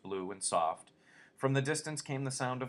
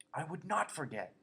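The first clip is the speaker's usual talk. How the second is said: usual speaking voice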